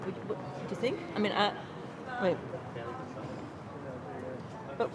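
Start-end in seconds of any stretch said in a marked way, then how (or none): none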